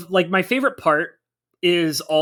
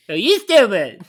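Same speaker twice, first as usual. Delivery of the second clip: higher, louder silly egg voice; high cartoon voice; 5 semitones higher, 5 dB louder than usual. Quoting the cartoon catchphrase again.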